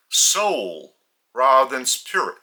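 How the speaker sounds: ordinary delivery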